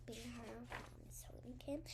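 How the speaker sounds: soft unvoiced whisper. A narrator's reading. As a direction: breathe the line out, no voice. Repeats sentence in a whisper.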